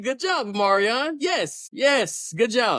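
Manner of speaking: cheering. Real, not acted. Mock cheering [name].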